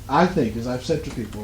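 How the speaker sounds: ordinary talk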